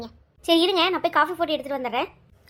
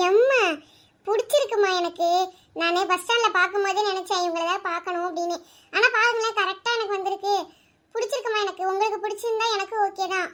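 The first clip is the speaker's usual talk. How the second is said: higher, shy daughter voice saying yes; daughter Anu; 4 semitones higher than usual. Acted. Daughter telling her mother she likes the groom, higher shy voice.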